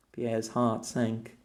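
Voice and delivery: ordinary delivery, nothing marked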